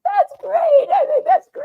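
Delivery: very high, delighted laughter through the words; laughing. Real, not acted. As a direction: crack up while saying it. Laughing at co-host's joke.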